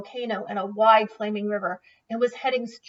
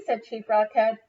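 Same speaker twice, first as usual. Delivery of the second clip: plain reading voice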